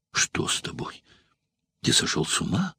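hushed, breathless fright; frightened. A narrator's reading. Valeria asks Spartacus if he's mad, hushed and frightened. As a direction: whisper it in alarm. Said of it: "испуганно произнесла Валерия".

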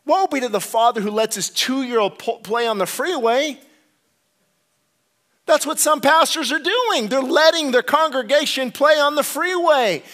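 high, loud impassioned preaching; full-force preaching. Real, not acted. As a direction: thunder the message to the congregation.

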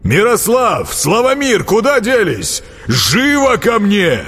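high, resounding call to servants; imperious high-pitched yell; ringing shout. A narrator's reading. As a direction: summon them with a ringing cry. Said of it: "звучно выкрикнул вновь прибывший".

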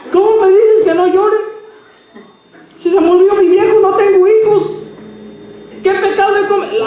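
high, loud anguished protest; desperate widow. Acted. Widow crying out to Jesus over her dead husband, high and loud. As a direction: cry it out, pleading and indignant. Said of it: "qué le dijo esta mujer".

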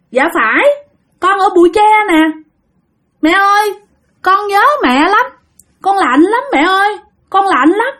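high, plaintive small ghost voice; child spirit. Acted. Lost baby tells mother it is cold, high plaintive voice.

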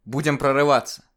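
громко и выше, с напором; брось это как боевой клич; решительный клич. Acted. Решительный призыв героя. (громкий решительный клич)

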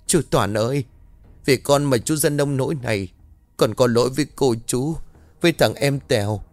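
sobbing over the dying man's body; anguished wail. Acted. Mai cries out over the dying servant, anguished wail. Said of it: "bất lực gào lên".

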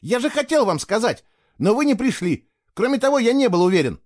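high, agitated self-defence; shouting back. A narrator's reading. Boy defending himself to his accuser, shouting. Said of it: "в ответ прокричал Фло".

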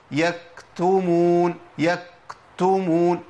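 يكتمون very slow, k clamped like a doubled consonant; wrong stopped k. Shown as a demonstration. Teacher showing the mistake of doubling the k. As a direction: stop the breath on the k of يكتمون. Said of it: "الخطأ".

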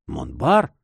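low start leaping into a question; mild, rising exclamation; startled cry. A narrator's reading. Captain jolted by the name, startled cry. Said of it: "вскричал он".

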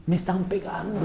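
soft, whiny mock victim; mock self-pity. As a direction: whimper it like a pitiful victim, softly. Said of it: "todo el pobrecito de mí". (queja lastimera y burlona, en voz baja)

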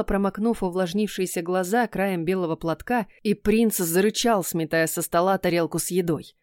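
plain reading voice

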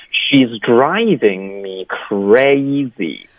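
"She's driving me crazy" very slow, DRIVING and CRAZY drawn wide; over-articulate all of She's driving me crazy; exaggerated full sentence. Shown as a demonstration. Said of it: "比较夸张的". (整句夸张慢读 She's driving me crazy)

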